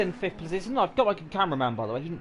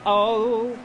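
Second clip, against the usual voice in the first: sudden loud higher gasp of surprise; startled loud "oh"; surprised; 5 semitones higher, 7 dB louder than usual. Real, not acted. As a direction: react to the crash. Car hits the wall.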